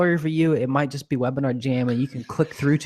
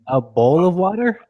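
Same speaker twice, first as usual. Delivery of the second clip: higher-pitched, questioning rise on water; puzzled echo; 6 semitones higher, 4 dB louder than usual. Real, not acted. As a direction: query it back in real puzzlement. Puzzled echo of misheard word.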